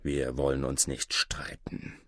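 low, soft conciliatory voice; freundlicher. A narrator's reading. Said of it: "wurde nun freundlicher". (leise, tief und versöhnlich)

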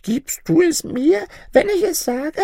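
high-pitched little granny asking; bargaining old granny. Acted. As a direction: wheedle in a piping old woman voice. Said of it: "fragte das Mütterchen".

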